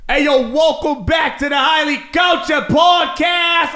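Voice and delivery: loud, high, slow mock East Coast accent; Boston-New York accent. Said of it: "like a Boston from New York".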